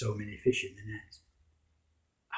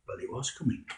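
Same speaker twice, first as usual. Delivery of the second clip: plain reading voice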